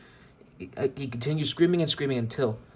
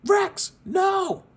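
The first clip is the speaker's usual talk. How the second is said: much higher, shouted cry of horror; horrified shout; 5 dB louder than usual. Acted. Woody and Buzz shouting in horror as Rex burns. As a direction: scream out as he burns. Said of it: "shouted in horror".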